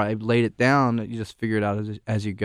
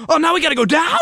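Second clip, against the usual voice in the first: loud, high-pitched panicked wail; hammed-up alarm, shooting up in pitch; mock freak-out; 6 dB louder, faster than usual. Imitating the passenger as the hill drops, freaking out. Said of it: "He'd be, like, freaking out".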